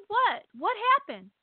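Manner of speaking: high-pitched, startled double question; retold bewilderment. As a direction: act out total confusion. Bewildered reply to shouting friend.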